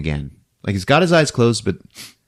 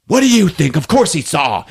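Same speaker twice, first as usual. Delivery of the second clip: raised, higher-pitched mock exasperation; exasperated retort; 6 semitones higher than usual. One character snaps back at a question in the retold film, exasperated. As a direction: act out an irritated comeback.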